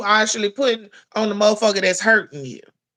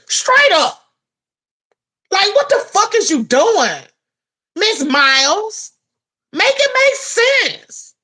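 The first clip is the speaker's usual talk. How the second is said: much higher and louder, furious; angry outburst; 10 semitones higher, 4 dB louder than usual. Real, not acted. Scolding Miss Miles to her face, furious high shout. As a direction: blow up at her in disbelief.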